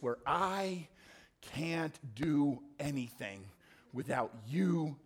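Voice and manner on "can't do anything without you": ordinary talk, nothing marked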